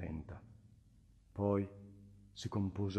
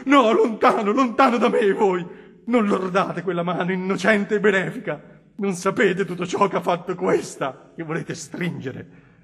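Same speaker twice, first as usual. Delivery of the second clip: higher, louder pleading cry, slowing at the end; anguished shouting; 10 semitones higher, 13 dB louder than usual. Acted. The Innominato cries out that his hand is unworthy, anguished. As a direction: push him away in anguish. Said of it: "gridò questo".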